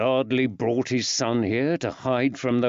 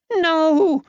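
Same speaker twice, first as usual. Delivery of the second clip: put-on high, loud child's scream of no; a frightened boy yelling in panic; terrified yell; 8 dB louder than usual. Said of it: "yelled the terrified boy".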